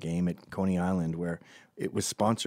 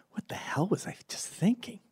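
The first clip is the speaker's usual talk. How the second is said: high, hushed disbelief; baffled whisper; 10 semitones higher, 5 dB quieter than usual. Acted. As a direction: whisper it to yourself, amazed at your own thoughts. Waking, baffled by own thoughts.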